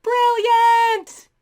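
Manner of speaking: high, loud villainous exclamation; gleeful mock shout. Evil scientists applauding their toy scheme, gleeful shout. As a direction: belt it out in mock triumph.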